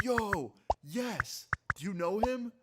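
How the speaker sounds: high-pitched imitation of an eager fan; excited fan. Fan in parking lot reacts to the YouTuber's name, excited imitation.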